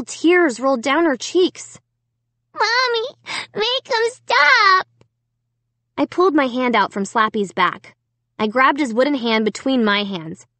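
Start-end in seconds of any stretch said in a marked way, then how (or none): 2.5-6.1 very high, drawn-out plea; high wailing child voice; sobbing little girl. A narrator's reading. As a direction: beg mommy like a small hurt child. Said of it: "the little girl was sobbing".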